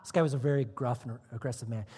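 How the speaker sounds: normal talking voice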